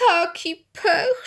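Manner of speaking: high, drawn-out tearful wail; hammed-up sobbing mum, high-pitched. A narrator's reading. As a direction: wail it slowly through sobs. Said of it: "szlochała mama".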